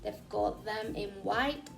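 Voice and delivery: normal talking voice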